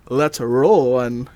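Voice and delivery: slow, loud, each word drawn out; slow emphatic reading. Shown as a demonstration. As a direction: spell out the plate slowly and loudly. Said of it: "his license plate says".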